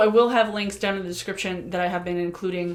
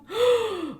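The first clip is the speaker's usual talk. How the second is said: a sharp, high shocked gasp; 13 semitones higher than usual. Real, not acted. Counting the years since his death, she gasps in shock. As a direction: suck in air as it hits you. Realises it was three years.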